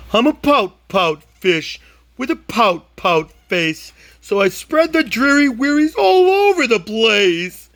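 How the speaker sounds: a moping fish, lifted high and droopy at the end; sulky fish voice. Acted. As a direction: play the glum fish, pitch jumping up. Fish refrain in character voice.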